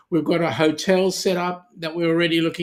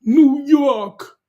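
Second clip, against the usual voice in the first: high, loud hammed-up New Yawk; mock American accent; mock New York accent; 9 semitones higher, 8 dB louder than usual. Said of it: "[name]'s getting into the spirit".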